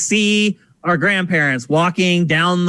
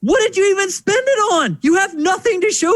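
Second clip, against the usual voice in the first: much higher, indignant grandfather rant; mock grandpa fury, pitched way up; angry grandfather; 13 semitones higher than usual. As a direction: play the grandfather outraged over wasted money. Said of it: "And so he's like".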